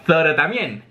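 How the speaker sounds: ordinary delivery, nothing marked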